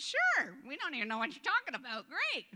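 hammed-up clueless enthusiasm, leaping up in pitch; eager mock converts. Imitated Christians agreeing eagerly to Peter's offer, excited.

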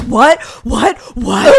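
loud, high, repeated exclamations; mock shock. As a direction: blurt it out in exaggerated disbelief. Acting out everyone's shocked reaction.

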